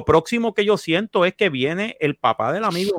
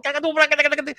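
crash noises; 10 semitones higher, much faster than usual. Retold or imitated. Imitating crash sounds.

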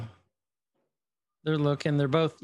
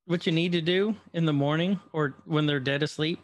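ordinary talk, nothing marked

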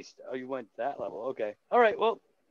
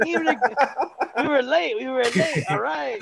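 high, loud mock letdown; much higher comic voice; mock disappointed fan; 8 semitones higher, 5 dB louder than usual. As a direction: fake an excited crowd backing off. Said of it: "They'd be like".

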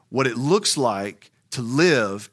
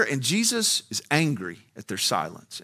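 normal talking voice

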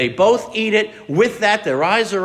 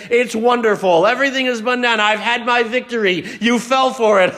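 higher, louder gloating villain; gloating devil; 5 semitones higher, 4 dB louder than usual. Acted. Satan gloats over Adam and Eve's fall. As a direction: crow over the victory like a cartoon devil. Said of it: "how he says".

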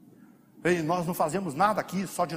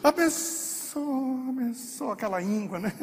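grudging blessing; 9 semitones higher, slower than usual. Retold or imitated. Believer praying for someone he resents, grudging and drawn-out. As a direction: bless him through clenched reluctance, stretching each word. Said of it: "abençoa ele".

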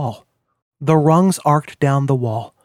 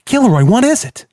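higher, louder urgent call; alarmed; 9 semitones higher, 4 dB louder than usual. A narrator's reading. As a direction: rush in, worried and loud. Friend shrieked, rushing over.

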